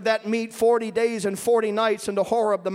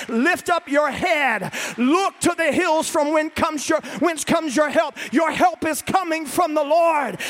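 loud, higher shouted exhortation, first words slow; full-force preaching; 5 semitones higher, 3 dB louder than usual. Acted. Preacher urging the congregation to look up, shouting. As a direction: lift the room, preach with all your lungs.

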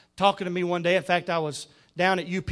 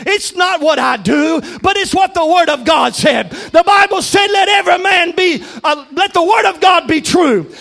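raised, loud, rhythmic pulpit delivery; full-force preaching; 8 semitones higher, 8 dB louder than usual. Acted. Preacher telling Brother Ronnie it's God's Word, full-force preaching. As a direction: drive each phrase home to the congregation.

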